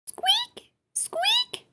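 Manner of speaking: very high squeaky squeak; rabbit squeaks. A narrator's reading. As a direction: squeak like a tiny bunny. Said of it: "welcomed Rabbit with a hop".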